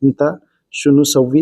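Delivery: Arabic phrase said slowly twice; slow it down so learners catch it; slow clear demo. Shown as a demonstration. Teacher to viewers, slow careful Arabic demo. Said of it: "तो आप अरबी बोलेंगे".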